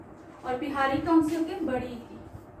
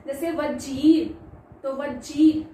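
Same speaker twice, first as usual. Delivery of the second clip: वजीर slow and louder, long ई; long ee stressed; 4 dB louder, much slower than usual. Shown as a demonstration. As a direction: draw out the ई in वजीर. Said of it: "long sound थी ना वजीर में". (वजीर में लंबी ई खींचकर)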